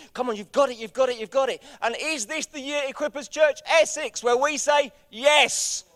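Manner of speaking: high, shouted rallying cry; full-force preaching. Real, not acted. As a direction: whip the church up to a shouted yes. Rallies church at full force.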